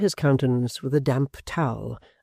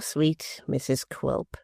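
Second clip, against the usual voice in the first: very slow, soft, stressing sweet; honeyed taunt; 6 dB quieter, much slower than usual. A narrator's reading. Quilp to his wife, slow honeyed taunt. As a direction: drip false sweetness.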